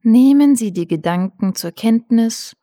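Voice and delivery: calm guided breathing; soothing spa whisper. Acted. Guided meditation.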